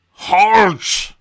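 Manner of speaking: loud, gravelly command; forceful shouted order in a rough voice; gravelly calling out. A narrator's reading. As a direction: bellow it like a commanding wizard. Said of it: "a deep, gravelly voice calls out".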